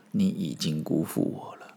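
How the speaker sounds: hushed whisper, low and soft; whispered verdict. Acted. The master telling his attendant in a soft disappointed whisper. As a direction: drop to a whisper, heavy with disappointment.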